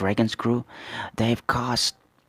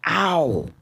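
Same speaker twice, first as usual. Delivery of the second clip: mock yelp; 8 semitones higher, 3 dB louder than usual. Character yelps in pain.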